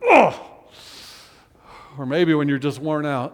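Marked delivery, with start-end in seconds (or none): 0.1-0.3 short frustrated grunt; an exasperated "ugh"; angry ugh. Shown as a demonstration. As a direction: grunt it out in annoyance. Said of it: "like when you're angry".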